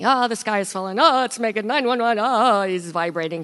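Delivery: hammed-up imitation of a man falling apart; Chicken Little panic. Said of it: "he goes all Chicken Little on me".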